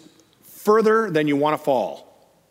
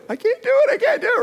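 very high, panicky imitation; mock freak-out; 4 dB louder, faster than usual. Imitating climber who won't step out, panicking. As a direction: squeal it like a climber refusing to jump. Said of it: "they freak out".

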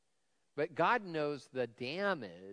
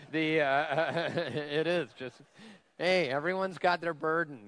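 laughing; 5 semitones higher, 8 dB louder than usual. Real, not acted. Joke about teaching Gentiles money.

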